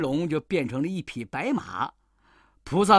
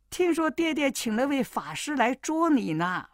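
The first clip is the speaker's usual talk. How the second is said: higher, pretending to be the daughter; a sly monkey posing as a girl; girl's voice; 6 semitones higher than usual. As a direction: play the young wife, pitched up. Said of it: "悟空说".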